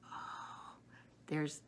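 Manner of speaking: normal talking voice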